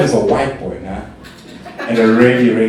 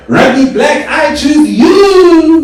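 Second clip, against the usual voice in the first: high, loud call with a long drawn-out last word; hammed-up cartoon trainer summoning a monster; mock Pokemon battle cry; 10 dB louder, much slower than usual. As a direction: shout it high, stretching the end.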